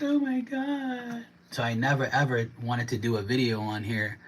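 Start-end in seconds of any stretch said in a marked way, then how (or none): 0.0-1.3 very high, slow, drawn-out mock gasp; mocking Karen voice. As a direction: stretch it out like a scandalised Karen. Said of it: "Always like".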